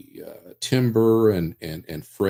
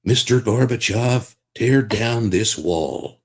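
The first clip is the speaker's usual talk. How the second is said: slow, firm presidential Reagan voice; Ronald Reagan voice; 2 semitones higher, 4 dB louder, slower than usual. Shown as a demonstration. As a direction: deliver it like a Reagan speech. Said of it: "I used to do Ronald Reagan".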